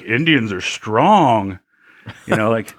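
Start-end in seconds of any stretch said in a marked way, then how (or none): 0.0-1.6 slow, drawn-out teasing imitation; mocking customer. Retelling a customer making fun of their heritage, mock drawl. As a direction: stretch each word, imitating a man poking fun. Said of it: "he would just be like".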